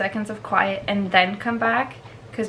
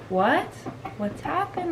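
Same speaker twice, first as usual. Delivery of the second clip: confused; 8 semitones higher, 4 dB quieter than usual. Pretend. Voicing the confused left dog.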